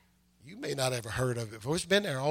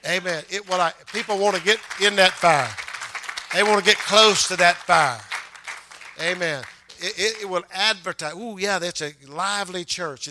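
loud, emphatic pulpit delivery; forceful preaching; 3 semitones higher, 7 dB louder than usual. Real, not acted. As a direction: drive it home to the congregation, louder. Preaching builds to full force.

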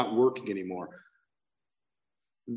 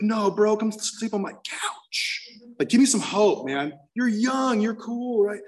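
higher, eager mock friend reassuring him; hoped-for friend; 8 semitones higher, much slower than usual. Imagined friend offering his couch, mock eager and higher. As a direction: play the buddy he wished for, much higher. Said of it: "I was hoping he'd say".

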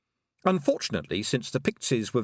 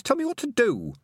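demanding; 14 semitones higher, 3 dB louder than usual. Acted.

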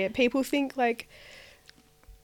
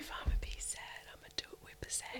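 whispering; 3 semitones higher, 13 dB quieter, faster than usual. Real, not acted. Whispering song words.

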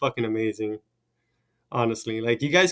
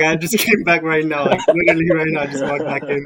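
louder, higher insistent defence; loud protest; 4 semitones higher, 8 dB louder than usual. Real, not acted. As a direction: protest your innocence loudly. Protests teasing about his absence.

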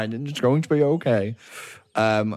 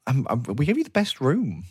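higher, stammering apology; flustered, helpless hotel clerk; meek receptionist; 6 semitones higher than usual. Acted. Receptionist apologises to an angry guest, meek and flustered. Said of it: "a meek human male kind of standing there".

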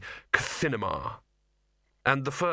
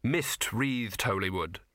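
plain reading voice

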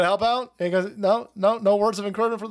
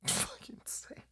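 laughing; 17 dB quieter than usual. Real, not acted. Laughing at absurd clip.

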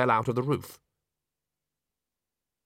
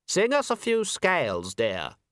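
high, patronising theatre voice; condescending high voice; 7 semitones higher than usual. Acted. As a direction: sweetly talk down to her. Patronising voice from the darkness.

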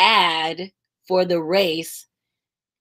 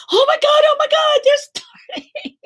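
very high, loud squeal of disbelief; squealing excitement; 4 dB louder than usual. Retold or imitated. Organizers at the race start, squealing with high excitement. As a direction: shriek it like you can't believe it's happening.

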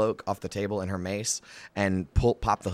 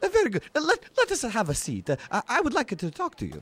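much higher, eager stammering writer; Volo; 13 semitones higher, 4 dB louder, faster than usual. Acted. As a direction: fuss and fluster like a pompous little chronicler. Volo again.

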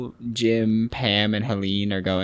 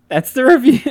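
loud, pitched-up mock outrage; indignant outburst; 13 semitones higher, 12 dB louder than usual. Real, not acted. Host reacting to a one-line review, loud mock outrage. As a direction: burst out at the tiny review.

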